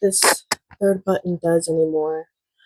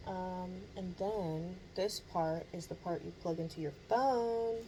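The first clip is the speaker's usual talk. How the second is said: quiet distant laptop mic; roomy, far-off built-in mic sound; built-in mic, no noise reduction; 24 dB quieter than usual. Shown as a demonstration. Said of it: "this is how it sounds off".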